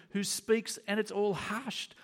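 high, incredulous scoff at a mere storm; lofty boast in a high, emphatic voice; indifferent God, acted. Preacher voices God unbothered by a storm, high and dismissive. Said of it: "he's asleep too because he's indifferent".

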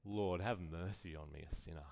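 low, soft, penitent prayer; acted contrite murmur; humble sinner's plea. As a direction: plead for mercy, hushed and low. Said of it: "he beats his breast and he says".

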